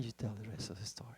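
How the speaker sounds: a quick hushed handover, no voice; unvoiced whisper passing the turn to Alvy; whispered aside. Real, not acted. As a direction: whisper it to your partner, voice off. Aside handing over to Alvy.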